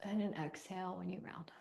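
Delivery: breathy whispered cue; whispering. Real, not acted. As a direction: whisper it softly. Soft cue during breathing exercise.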